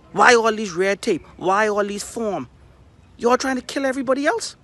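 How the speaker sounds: accusing cry at the government; angry shout. Real, not acted. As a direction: hurl the questions in fury.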